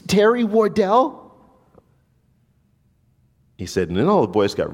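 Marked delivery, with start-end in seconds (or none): 0.1-1.2 much higher, stammering small voice; high-pitched scared answer. Retold or imitated. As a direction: squeak out your name like a frightened kid. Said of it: "he answered in this high-pitched voice".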